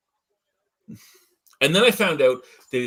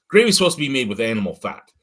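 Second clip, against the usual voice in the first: ordinary talk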